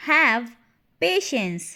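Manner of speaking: a firm "Have", pause, then "patience"; word by word. Shown as a demonstration. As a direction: split "Have patience" into two separate words. Word-by-word repeat for learners. ("Have patience" रुक-रुककर, शब्द अलग-अलग)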